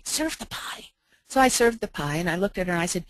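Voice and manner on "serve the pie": put-on high, soft whisper of a child at the table; whispered plea. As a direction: urge it under your breath, serve the pie. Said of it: "I heard this little voice say".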